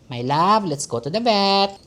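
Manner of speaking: high, drawn-out singsong coo; singsong to a pet; cooing pet talk. Real, not acted. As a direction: sweet-talk the cat, stretching the last word. Coaxing her cat to the vet.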